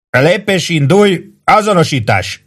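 high-pitched sentry orders, mildly forceful; understated boom of a sentry; booming command. A narrator's reading. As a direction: call out drill commands. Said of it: "dörögte a hang".

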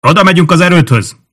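high, loud shout towards distant sentries; calling out. A narrator's reading. Centurion hailing the patrolling sentries from afar, shouting. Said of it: "oda kiáltott a járőröző őrszemeknek".

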